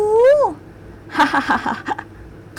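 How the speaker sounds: a short high amused laugh. Acted. Man laughing at the girl's wide-eyed excitement, amused laugh. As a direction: laugh fondly at her childish awe. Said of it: "ขำอะไรคะ".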